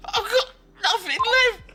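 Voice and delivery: very high squeaky lament; chipmunk voice. Acted. Soundboard clip played.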